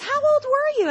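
pitched high, eager quizzing; high mock Rosie question. Rosie O'Donnell quizzes mom about coming out, high and eager. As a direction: ask it way up high, nosy.

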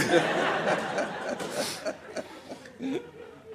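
long breathless laugh. Real, not acted. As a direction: collapse into helpless laughter. Cracking up at punchline.

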